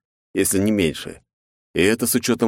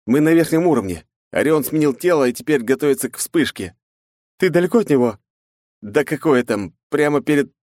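urgent male voice; 8 semitones higher, 3 dB louder than usual. Acted. Ichiro urgent on radio.

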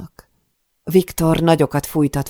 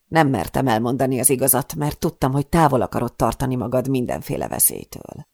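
plain reading voice

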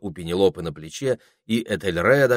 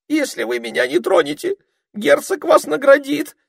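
very high frightened babble; babbling; 7 dB louder than usual. A narrator's reading. Ermine babbling with eyes shut, high and scared. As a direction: babble in fear. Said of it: "лепетал горностай".

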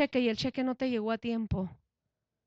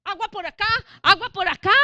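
mock panic; 7 semitones higher, 7 dB louder, faster than usual. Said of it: "tú dices".

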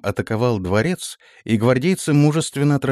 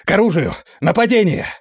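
high, urgent cry of alarm; desperate shout; 11 semitones higher, 3 dB louder than usual. A narrator's reading. Guards shout from the square outside, desperate alarm. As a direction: raise the alarm at the top of the voice. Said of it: "громкий отчаянный крик нескольких глоток".

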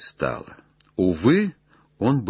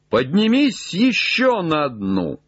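high raised order; calling up; 7 semitones higher than usual. Acted. Legrand orders Jupiter one branch higher, raised call. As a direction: send the order up to the treetop.

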